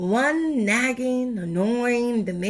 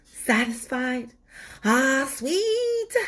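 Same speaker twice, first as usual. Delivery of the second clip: higher, pleased sigh of relief; satisfied sweet tooth; 5 semitones higher, slower than usual. Acted. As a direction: savor it like the candy just landed. Tooth sighs satisfied after candy.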